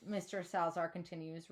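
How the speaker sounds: usual speaking voice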